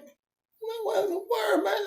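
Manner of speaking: high, astonished "what in the world"; stunned disbelief. Retold or imitated. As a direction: act out being floored by the smell. Mock surprise at the smell.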